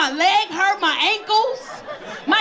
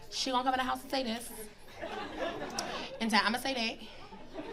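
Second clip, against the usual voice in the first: soft, quick muttered rehearsal; daughter rehearsing argument; 4 semitones lower, 14 dB quieter than usual. Retold or imitated. Daughter practicing an argument alone in her room, soft and muttered. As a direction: rehearse the fight under your breath. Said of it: "All I hear".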